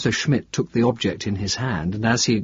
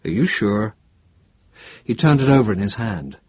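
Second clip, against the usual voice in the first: plain reading voice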